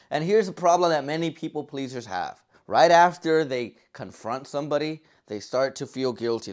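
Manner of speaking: ordinary delivery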